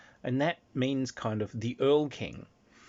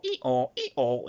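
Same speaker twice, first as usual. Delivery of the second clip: mock hee-haw; donkey bray; 3 semitones higher, 4 dB louder than usual. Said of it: "more of a donkey".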